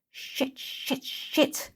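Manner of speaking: whispered, frantic swearing; panicked whispered curses. Acted. Kesa seeing Jay's cursed speech, whispered panic.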